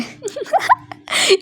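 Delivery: sudden high-pitched giggle at herself; high giggle burst. Real, not acted. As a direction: burst into a squeaky laugh. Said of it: "ignore करो मुझे". (कैमरे को देखकर ऊँची खिलखिलाहट)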